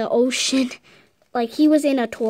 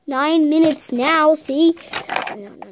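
usual speaking voice

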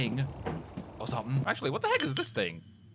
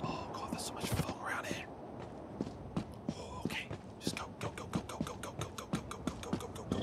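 urgent whispered go go go; whisper-hurry through the fog; 12 dB quieter, faster than usual. Real, not acted.

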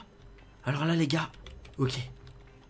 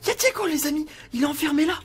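higher, urgent hushed alarm; alarmed discovery; 7 semitones higher than usual. Pretend. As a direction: gasp out what you have just spotted. Discovers his son crying, trapped. (découverte affolée, voix aiguë)

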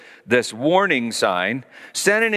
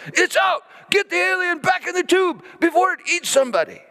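high, fast, urgent shouting, much higher and faster; frantic shout. Retold or imitated. Technician crying out the alien is loose, frantic high shout. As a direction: yell like the monster just escaped. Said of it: "the guy yells".

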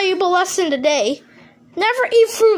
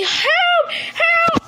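high desperate cries for help; screaming for help; 9 semitones higher than usual. Acted. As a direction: yell for rescue in panic. Calling for help.

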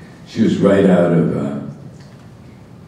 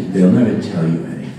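ordinary talk

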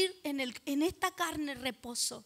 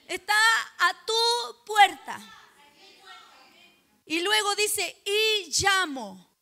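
loud, raised, insistent pulpit delivery; emphatic preaching; 5 semitones higher, 7 dB louder than usual. Real, not acted. Preacher telling the congregation the Lord is at their door, emphatic. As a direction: hammer each short phrase and let it ring.

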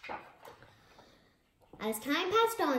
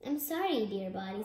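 plain reading voice

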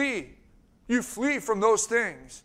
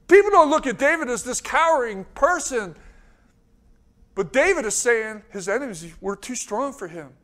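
ordinary delivery

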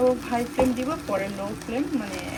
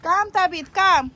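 Come called out high; calling out; 8 semitones higher, 8 dB louder, slower than usual. Real, not acted. Calling the baby to come.

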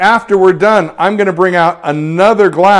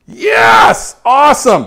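sudden high, loud yell of delight; excited cheer; 7 semitones higher, 5 dB louder, much slower than usual. Real, not acted. Photographer sees a great light painting, cheering loudly. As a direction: burst out at the result.

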